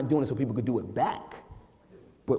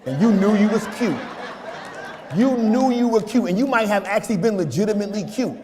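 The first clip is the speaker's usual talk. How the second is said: high, loud laughing preacher; loud preaching; 5 semitones higher, 7 dB louder than usual. Acted. Pastor teasing sisters about their youth, loud preaching. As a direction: preach it big, teasing the church.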